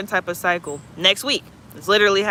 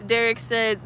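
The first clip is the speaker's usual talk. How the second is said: ordinary delivery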